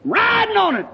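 high, exultant holler imitated; acted joyful cry; retold hollering. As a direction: holler it high, as if riding the rapids. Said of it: "going down, hollering".

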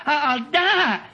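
high, slow desperate plea; a man at the end of his strength begging God; pleading. Retold or imitated. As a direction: drag it out like a dying man begging. Retold prayer of dying man.

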